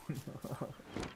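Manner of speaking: soft airless laugh at own joke; breathy giggle. Real, not acted. Gamer giggling after joking about a teammate Mason, soft laugh. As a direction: chuckle quietly to yourself.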